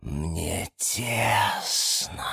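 very slow, quiet ghostly drawl; mysterious voice. A narrator's reading. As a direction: stretch it out softly like an unseen presence. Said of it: "снова сказал ему тот же голос".

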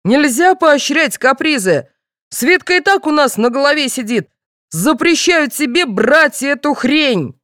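high, indignant forbidding; yelling father. A narrator's reading. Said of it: "заорал отец".

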